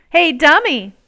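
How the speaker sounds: loud mocking playground call; taunting shout. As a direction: jeer at her loudly across the playground. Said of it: "a boy called out".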